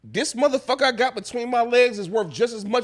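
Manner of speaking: hammed-up pimp boast; pimp bragging. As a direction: brag like a pimp. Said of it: "go on some pimp shit".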